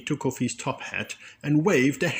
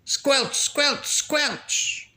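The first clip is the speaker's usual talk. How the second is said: higher, playful squelch; squelchy sound; much slower than usual. A narrator's reading. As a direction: mimic boots in mud. Mud sound effect.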